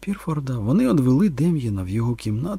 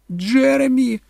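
high, loud cry for help; barely controlled panic; 14 semitones higher, 6 dB louder, slower than usual. A narrator's reading. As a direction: scream for her husband. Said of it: "Кетрін уже ледве володіла собою". (панічний крик)

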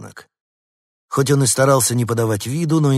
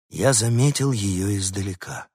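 ordinary delivery

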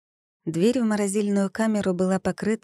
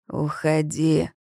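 slow, low icy command; commanding order; 3 semitones lower, slower than usual. A narrator's reading. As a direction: order her out, slow and cold. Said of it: "приказал он".

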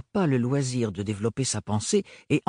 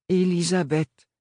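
stiffly; 5 semitones higher, slower than usual. Acted. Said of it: "articulées avec raideur".

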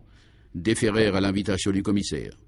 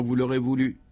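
ordinary delivery, nothing marked

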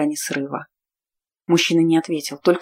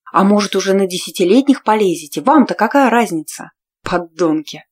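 louder, raised voice hurling accusations; angry tirade; 2 semitones higher, 5 dB louder than usual. Acted. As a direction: spit scorn at the warriors. Heroine's furious tirade.

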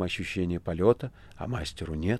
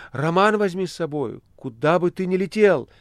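much higher, louder pleading cry; shouting; 9 semitones higher, 4 dB louder than usual. A narrator's reading. Margarita shouting to the master, imploring and loud. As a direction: beg him loudly to take it along. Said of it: "кричала она мастеру".

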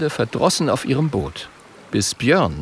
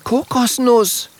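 high, drawn-out scolding name; high-pitched reproach; reproachful; 13 semitones higher, slower than usual. A narrator's reading. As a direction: call the name accusingly. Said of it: "sagt Mathilda vorwurfsvoll".

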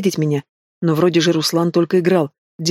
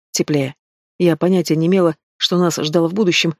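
usual speaking voice

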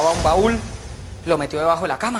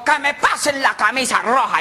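slow, raised commanding call; acted shouted command; captain's shout; 5 semitones higher, slower than usual. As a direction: order the crew as their captain. Captain shouts his order again.

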